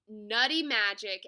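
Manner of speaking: loud, full-voiced cheer; shouting. A narrator's reading. All the animals celebrating the nuts, shouting. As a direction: shout it like a crowd. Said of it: "everyone shouted".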